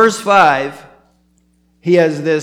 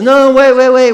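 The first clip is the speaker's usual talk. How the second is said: much higher and louder, rushing to stop him; urgent call; 8 semitones higher, 7 dB louder than usual. Retold or imitated. Paul to the jailer in prison, urgent shout. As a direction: cry out before he harms himself.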